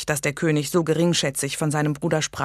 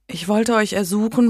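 a lightly voiced young woman, higher and unsure; timid young lady; 6 semitones higher, 4 dB louder than usual. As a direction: ask the king hesitantly, a little higher. Megan pleads with the king.

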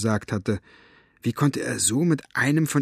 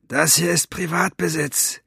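higher-pitched, feline menacing stranger; cat-voiced villain; 6 semitones higher than usual. A narrator's reading. Armed stranger warning off trespassers, catlike voice. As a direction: warn them off in a catlike voice. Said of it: "die Katzenstimme aus Elinors Bibliothek".

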